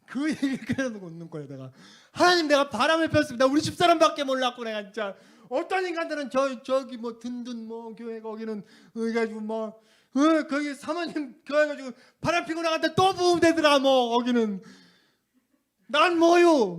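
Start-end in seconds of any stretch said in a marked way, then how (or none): 2.1-5.2 high, loud anguished pleading; desperate cry to God. Retold or imitated. Pastor retelling his prayer to God, high loud crying out. 5.5-14.6 high, loud bitter complaint; indignant rant. Retold or imitated. Pastor reliving his complaint to God about other churches, high and loud. 15.9-16.8 high, loud cry of protest; shouted protest. Retold or imitated. Pastor retelling how he screamed at God, high and loud. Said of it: "소리 지르고".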